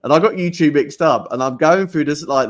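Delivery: ordinary talk, nothing marked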